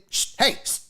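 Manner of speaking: put-on hissed shush at a kid; whispered stern shush at a stranger's child. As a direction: shush the misbehaving kid sharply. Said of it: "I'll shush a kid".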